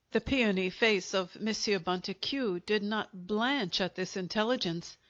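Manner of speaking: ordinary delivery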